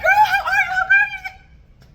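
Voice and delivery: very high, loud squealing hello; super excited greeting. Retold or imitated. Greeting her high school friend dealer, excited high squeal. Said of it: "I got super fucking excited".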